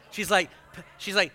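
usual speaking voice

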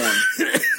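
shrill squealing laugh; real high laugh. Real, not acted. Laughs hard.

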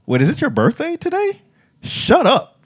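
high, squealing disbelief; hammed-up astonishment; mock surprise. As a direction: dancer amazed at the shared birthday. Second dancer's surprise.

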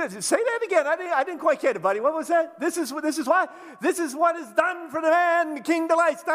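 loud, high teasing, ending in a mock proclamation shout; mocking taunt. Mordecai teasing Haman to shout it again, loud and gleeful. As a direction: needle him like a gleeful heckler. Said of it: "I'd be like".

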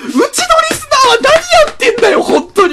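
very high, loud yell; outraged shout. Acted. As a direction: scold them at full volume. Mock rant.

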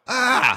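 high, loud mock scream of fright; hammed-up frightened yelp; startled scream. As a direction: shriek like someone seeing a ghost. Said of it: "they're like".

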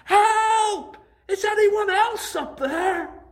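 high loud slow shout; crying out for help. Acted. Jack shouts for someone else, desperate. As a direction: yell for anyone else, punchline. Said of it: "he cried out".

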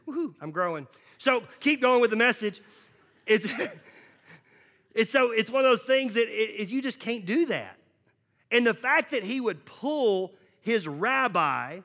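usual speaking voice

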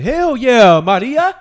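loud, high cheer of approval. Pretend. A fan cheers Maria cursing LeBron, loud and high. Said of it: "would you be like".

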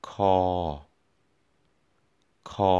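คอ on a level flat tone. Shown as a demonstration. Teacher modelling คอ for learners, flat tone. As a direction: hold คอ steady in pitch. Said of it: "flat tone".

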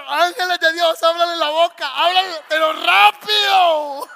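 hammed-up yelling for angels to hurry; mock desperate shout. Pide a los ángeles a gritos. (grito exagerado y agudo pidiendo rapidez)

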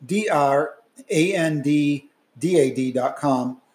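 loud, polished outro announcement; announcer read. Acted. Recorded podcast outro read.

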